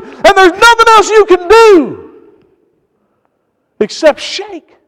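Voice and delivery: high, insistent pulpit voice; impassioned sermon delivery; fervent preaching. Real, not acted. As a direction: drive the point home like a preacher. Preaching at full force.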